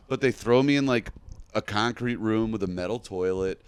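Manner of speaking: usual speaking voice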